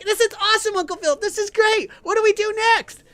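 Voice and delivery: put-on very high, thrilled little boy; excited kid voice. Nephew thrilled about the hook in his nose, very high kid voice. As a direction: squeal it like an eager kid. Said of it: "my nephew's like".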